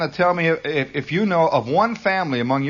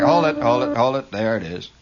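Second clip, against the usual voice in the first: normal talking voice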